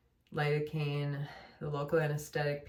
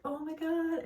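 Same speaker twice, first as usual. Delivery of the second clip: a much higher, awed "oh my God" as the pain lifts; relieved delight; 13 semitones higher than usual. Retold or imitated. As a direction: gush it out like sudden relief. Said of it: "I was just like".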